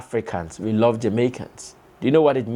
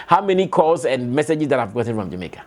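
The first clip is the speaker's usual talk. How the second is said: ordinary talk, nothing marked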